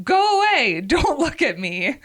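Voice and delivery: high, loud shriek that eases off; acted scream to send onlookers away; mortified scream. A narrator's reading. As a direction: cry out in embarrassment, first words highest. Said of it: "completely mortified and started screaming".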